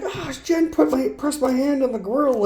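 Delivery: a hint of a hurt kid telling on his sister; tattling little kid. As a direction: run crying to Dad, tattling. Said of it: "I'm like".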